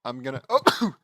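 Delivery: loud sudden sneeze burst; real sneeze. Real, not acted. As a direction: let a real sneeze explode out. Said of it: "I'm totally gonna sneeze".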